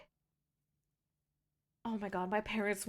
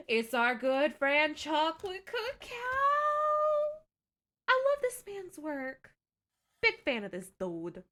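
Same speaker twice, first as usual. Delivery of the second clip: excited; 7 semitones higher, 4 dB louder than usual. Real, not acted. Excited to see cake.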